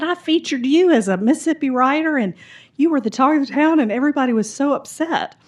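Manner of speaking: high, loud, animated Southern gossip; gushing librarian. Acted. Librarian telling the author about the town scandal, gushing. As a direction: dish the town gossip with relish.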